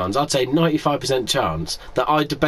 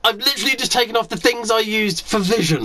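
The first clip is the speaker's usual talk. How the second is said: indignant rant; 10 semitones higher than usual. Real, not acted. Mock indignant glasses rant.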